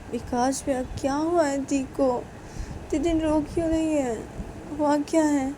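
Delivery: a lightly voiced anxious younger brother; worried brother. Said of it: "Rahul विकास को बोलते".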